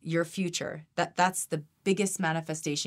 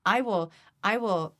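ordinary delivery

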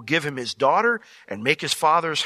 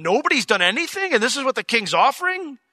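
higher-pitched, mildly incredulous; baffled disbelief; 7 semitones higher than usual. Acted. David looking at the idle army, baffled. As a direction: question it as if it makes no sense. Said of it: "because he doesn't understand".

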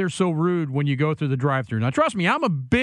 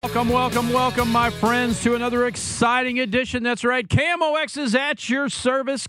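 big, higher sing-song radio welcome; booming sing song welcome; 6 semitones higher than usual. Real, not acted. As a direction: boom out the welcome, up high. Radio host opening the show.

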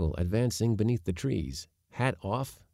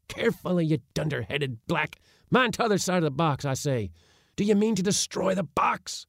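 high, urgent scolding commands; agitated orders; 9 semitones higher than usual. A narrator's reading. Uncle shoving off, fearful for his box, agitated orders. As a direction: fuss and scold while giving orders.

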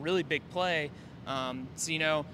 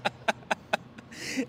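voiceless held-back laugh; stifled laugh; 5 semitones higher, 4 dB quieter than usual. Real, not acted. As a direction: let a suppressed laugh slip. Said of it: "I'm trying not to laugh".

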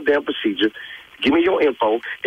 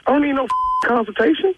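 incredulous, rising outrage; indignant disbelief, pitched way up; outraged; 10 semitones higher than usual. Real, not acted. Outraged at prank caller.

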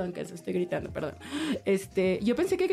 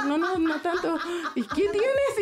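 partner laughing; 9 semitones higher, slower than usual. Retold or imitated. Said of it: "me dijo".

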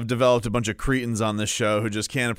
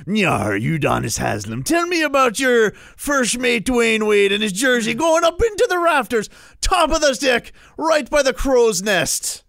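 high, slow, loud pirate growl; 11 semitones higher, 4 dB louder, slower than usual. Pretend. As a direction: ham up a pirate captain. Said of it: "what you want me to do".

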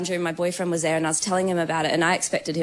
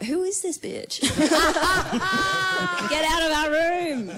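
mock outrage; 9 semitones higher than usual. Yelling at the ghost, laughing.

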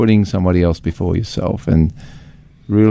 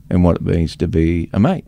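usual speaking voice

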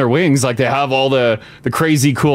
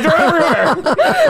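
mock [name] voice; 13 semitones higher, slower than usual. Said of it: "'cause she's like".

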